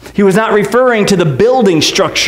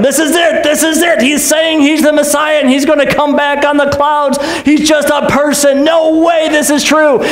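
indignant high priest; 5 semitones higher than usual. Retold or imitated. High priest charging Jesus.

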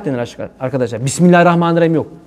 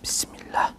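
Bismillah whispered without voice; 14 dB quieter, slower than usual. Shown as a demonstration. Preacher mocking a whispered Bismillah. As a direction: barely breathe out Bismillah. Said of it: "ya da ne yaparsın".

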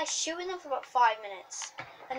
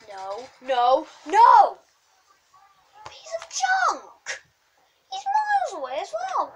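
real rage building to a high yell; frustrated outburst; 8 semitones higher, 9 dB louder, much slower than usual. Real, not acted. Gamer rages at his useless gun, frustrated outburst. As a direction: shout it higher and louder at the game. Raging at missing shots in game.

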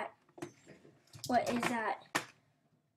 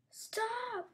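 ordinary talk

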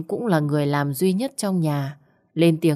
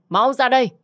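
full shout; 8 semitones higher, 5 dB louder than usual. Acted. Mother-in-law at the door, shouting at full force. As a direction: repeat it as a much higher, much louder yell. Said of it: "Bà hét lớn với cô".

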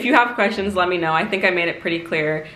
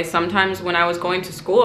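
ordinary talk

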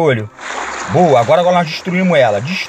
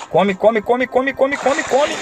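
rising, faster chant of come, getting higher each time; excited urging; 8 semitones higher, slower than usual. Real, not acted. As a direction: egg the creature on, pitch climbing. Urging the character to eat.

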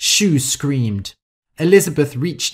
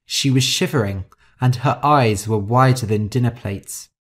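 ordinary delivery, nothing marked

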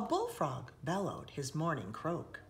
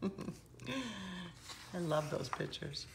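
laughing aside; 5 dB quieter, much slower than usual. Real, not acted.

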